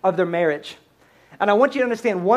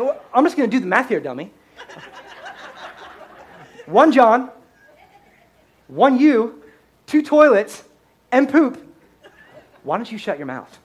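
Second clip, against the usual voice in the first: sarcastic comeback; 2 semitones higher, 3 dB louder than usual. Retold or imitated. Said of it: "I'm like".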